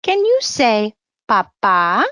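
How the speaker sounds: usual speaking voice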